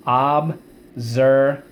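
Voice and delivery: Observation stretched out, x slow; slow model word. Shown as a demonstration. Teacher models the long word slowly for the class. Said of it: "Let's go".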